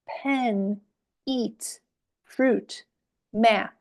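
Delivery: one word at a time, clear pause after each; spaced-out teacher's model for repeating; modelled word list. Shown as a demonstration. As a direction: read each word as one separate falling beat. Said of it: "And then say the words".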